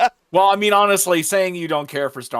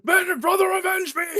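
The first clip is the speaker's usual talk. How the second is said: much higher, shouted battle cry; space marine voice; 3 dB louder than usual. Pretend. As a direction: bellow it like a hammed-up space marine. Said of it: "I wish I could do a Space Marine voice".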